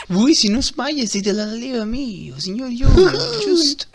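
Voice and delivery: high laughing voice. Acted.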